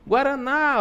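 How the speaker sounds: "Guaraná" called out high, stretching the final "ná"; calling the word. Shown as a demonstration. Teacher demonstrating the calling trick, "Guaraná" high with "ná" stretched. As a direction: call "Guaraná" like a name, holding "ná". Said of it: "dica pra vocês de chamar a palavra".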